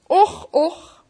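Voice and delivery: put-on sighing old man's och. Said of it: "zei papa Panov".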